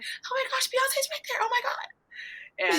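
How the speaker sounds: very high squeal to a friend; starstruck panic. Retold or imitated. Excitedly tells friend of star.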